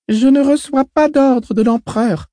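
put-on high girl's voice, slow and defiant; a small girl refusing orders; child voice. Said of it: "dit Alia".

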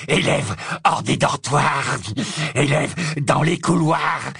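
slow, stretched alarm call; crying out. A narrator's reading. Old caretaker raising the alarm about pupils, crying out. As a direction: draw out each warning like a watchman. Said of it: "et criait".

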